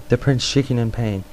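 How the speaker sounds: ordinary delivery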